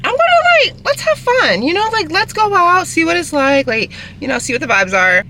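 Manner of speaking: high, giddy and upbeat; bubbly let's-have-fun voice; excited. Retold or imitated. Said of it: "But I was like".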